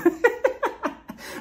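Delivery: high breathy laugh. Real, not acted. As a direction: break into laughter. Laughing at Drew's talking.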